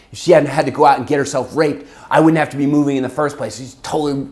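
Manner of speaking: loud, high imitated tirade; angry mother. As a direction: rant like a mother blaming her kid. Retold furious mother blaming her daughter.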